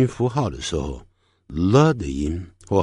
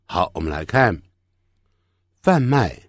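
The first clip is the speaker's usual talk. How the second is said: ordinary delivery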